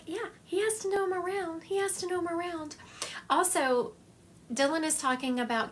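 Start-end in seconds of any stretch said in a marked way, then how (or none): 0.1-2.8 lifted high voice for the spirit's words; quoting the spirit. Retold or imitated. Medium relays spirit's insistence in a higher voice. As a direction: raise the pitch, relaying him eagerly. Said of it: "He's like".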